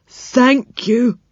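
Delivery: put-on high, slow, tearful old man voice; a delighted old fellow near tears; old saucepan man moved by a gift; touched old saucepan man.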